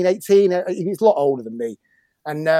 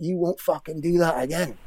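lightly imitated Scottish accent, softer voice; 8 dB quieter than usual. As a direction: mimic a Scottish player, kept quiet. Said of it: "he says in this Scottish accent".

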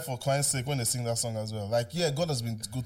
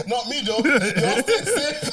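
laughing; 7 semitones higher, 5 dB louder, much slower than usual. Real, not acted. Laughing slow joke.

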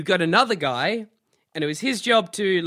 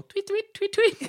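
high little birdsong tweets; imitated bird tweets; slower than usual. Player sets the bird on the table, high tweeting. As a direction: chirp like a small bird, very high.

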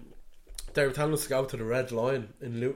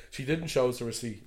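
ordinary delivery

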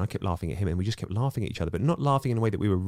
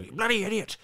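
mock angry outburst. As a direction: ham up a stranger losing his temper. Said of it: "when people lose it, go".

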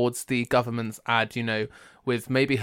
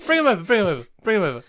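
insistent urging; 7 semitones higher, much faster than usual. Pretend. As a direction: keep pressing him to play along. Insisting again.

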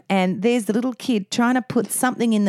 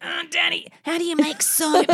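put-on little boy stalling; 5 semitones higher than usual. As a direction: imitate a kid inventing questions for Daddy. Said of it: "And he'll be like".

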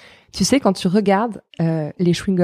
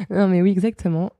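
ordinary delivery

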